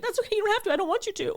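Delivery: put-on high, pert, dismissive teenage voice; high-pitched mock teenager; snippy teen. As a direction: mimic yourself as a sassy kid shutting mom down. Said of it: "And I was like".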